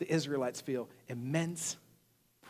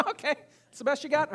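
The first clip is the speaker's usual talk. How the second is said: high-pitched, unimpressed comeback; amused disbelief; 9 semitones higher than usual. Acted. Listener answering weak trash talk, amused disbelief. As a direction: scoff at a feeble insult in a raised pitch. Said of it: "I'm kinda like".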